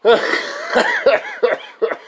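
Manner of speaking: high squealing burst of laughter; real high-pitched laugh. Real, not acted. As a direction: break into a shrill laugh. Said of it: "We had to laugh about that".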